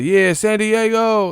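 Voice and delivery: high, slow drawn-out hometown shout; mocking Keith Thurman. Streamer imitating the boxer greeting the wrong city. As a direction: stretch it out like a proud ring greeting. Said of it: "And he said".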